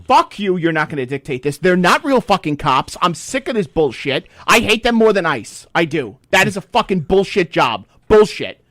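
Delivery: angry rant. Real, not acted. He rants at TSA guards, loud and real angry. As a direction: let it all out at TSA. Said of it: "Sorry, I'm done".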